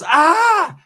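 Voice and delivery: high-pitched retold scream; mock scream. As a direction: imitate a woman's startled scream. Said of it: "She starts screaming".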